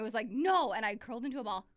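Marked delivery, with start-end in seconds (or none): 0.3-0.7 high, loud retold "No"; mock loud refusal. Refusing boyfriend's needle, mock loud shout. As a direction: shout the refusal like a scared kid.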